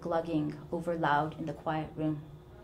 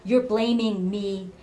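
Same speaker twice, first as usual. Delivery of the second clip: slow, louder and higher accusation; accusing; 3 semitones higher, 9 dB louder, slower than usual. Acted. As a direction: lay the blame back on her. Mother accuses daughter.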